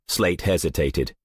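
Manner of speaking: plain reading voice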